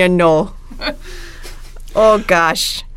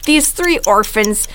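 put-on light Minnesota Fargo accent, slowed in places; put-on Fargo Midwest accent. Host telling the plot for the game in a Fargo accent. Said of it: "that was a solid accent right there".

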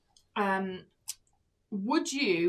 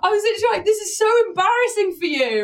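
loud, high-pitched mock outrage; exasperated retold shout; 10 semitones higher, 9 dB louder, faster than usual. Reliving watching a woman stuck at gates, exasperated shout. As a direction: cry out in second-hand embarrassment.

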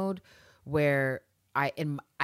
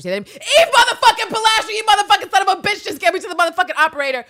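very high, loud, fast ranting yell; furious shout; 11 dB louder, faster than usual. Retold or imitated. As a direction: lose it completely at the machine. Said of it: "I progress as quickly as Villanelle did".

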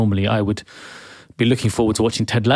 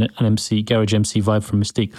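usual speaking voice

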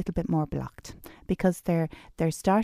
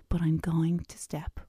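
whisper; 6 dB quieter than usual. Retold or imitated. Quiet resolve after loud declaration.

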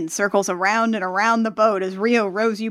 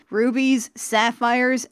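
plain reading voice

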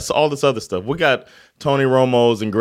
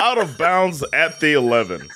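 higher mock play-by-play call; Gus Johnson; 5 semitones higher than usual. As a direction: call it grandly like a sportscaster. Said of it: "Gus Johnson with the".

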